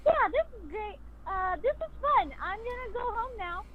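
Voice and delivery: tossing it off, carefree and bubbly; silly Helen. Retold or imitated. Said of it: "I really love how silly Helen is".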